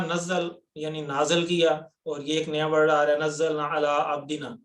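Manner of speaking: ordinary talk, nothing marked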